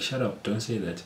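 soft, restrained telling-off; calm manner. Shown as a demonstration. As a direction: say it quietly, without rising to it. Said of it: "but in a calm manner".

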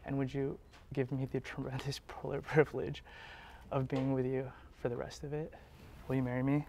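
hushed and tender; soft proposal. Real, not acted. Proposing on one knee.

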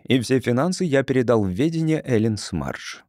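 usual speaking voice

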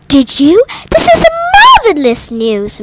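high, loud, astonished cry of joy; a mother thrilled by sudden news; shocked delight. A narrator's reading. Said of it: "cried in shock".

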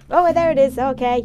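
sudden high, loud exclamation; real startled discovery; loud surprise. Real, not acted. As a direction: burst out on spotting it. Lost house suddenly reappears.

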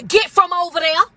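put-on firm mama calling the kids home; old-school mama. Elder mama calling her child off somewhere she shouldn't be, firm. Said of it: "That's how they said it".

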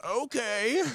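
high, put-on shouted catchphrase; old guy voice. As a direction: squeal it as a caricature. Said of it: "[name] did the Deckard voice".

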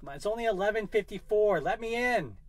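pleading high with the door; strained high pleading at the door; high strained plea. Acted. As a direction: beg the lock to open. Struggling with the door.

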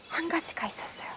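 fast whispered secret. Shown as a demonstration. 아이들에게 비밀을 속삭이는 말투. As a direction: let the children in on a secret. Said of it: "teasing secret to kids".

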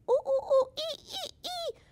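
very high monkey ooh ooh ee ee; high chattering monkey calls; monkey screeches. A narrator's reading.

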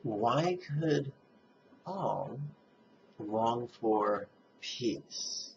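slow, with long pauses between phrases; contemplative question. Shown as a demonstration. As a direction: pose it slowly, leaving room to reflect. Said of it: "rhetorical, contemplative question".

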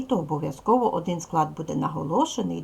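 ordinary talk, nothing marked

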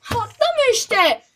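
loud, high and slow complaint; exasperated shout. Real, not acted. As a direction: wail at the stuck jump.

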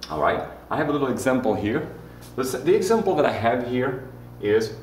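usual speaking voice

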